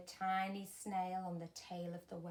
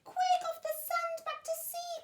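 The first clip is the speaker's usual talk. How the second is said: very high, urgent little shout; urgent cry; 5 dB louder than usual. A narrator's reading. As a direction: hurry the stranded whale. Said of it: "cried the snail".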